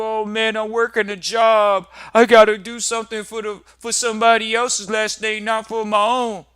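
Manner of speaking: high, loud, whining complaint about the job; mock weary worker. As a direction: moan like someone stuck working for others. Mocking a worker client.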